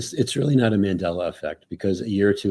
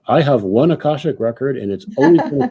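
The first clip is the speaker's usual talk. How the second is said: higher, louder mock declaration; overstated certainty, pitched up; 5 semitones higher, 6 dB louder than usual. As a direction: voice a naive, emphatic believer. Mocks a rigid view.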